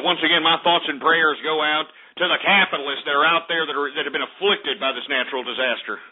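ordinary talk

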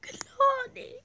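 high anguished cry of the name; desperate call. Acted. Calling out for sick friend.

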